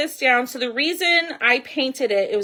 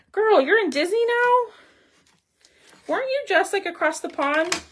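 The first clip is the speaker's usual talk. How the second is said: surprised; 10 semitones higher, 4 dB louder than usual. Real, not acted. Surprised greeting to viewer Christina.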